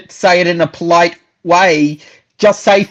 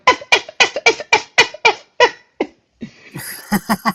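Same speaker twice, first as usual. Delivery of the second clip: very high squawking "F, F, F"; chicken-like squawk; 3 dB quieter, much slower than usual. Retold or imitated. Retelling the stage show to friend, very high chicken-like squawks. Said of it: "we were just going".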